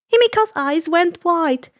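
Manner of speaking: usual speaking voice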